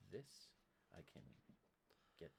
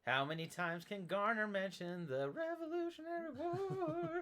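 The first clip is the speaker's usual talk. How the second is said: mock exasperation; 14 semitones higher, 5 dB louder than usual. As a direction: belt it out in hammed-up complaint. Mock exclaiming about Garner's war mentions.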